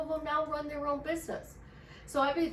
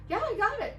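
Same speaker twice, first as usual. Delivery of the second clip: much higher and louder, bursting with good news; excited reply; 10 semitones higher, 5 dB louder than usual. Retold or imitated. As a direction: announce it like happy news to a friend. Friend reporting she got it.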